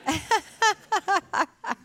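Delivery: high, loud rapid laugh; real laughter. Real, not acted. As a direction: burst out laughing at your own slip. Laughing on about her memory slip.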